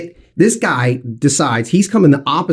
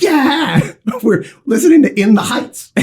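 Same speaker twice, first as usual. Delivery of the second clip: excited cheer; 9 semitones higher than usual. Retold or imitated. Kids cheer in the car.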